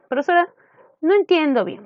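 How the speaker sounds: student voice. Acted. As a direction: imitate a student appealing to the teacher. Voicing a student's confused objection.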